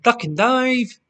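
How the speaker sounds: loud, drawn-out bingo call; bingo caller. Acted. Quiz host imitating a bingo shouter, calling out slowly. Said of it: "if Bingo Shouter said".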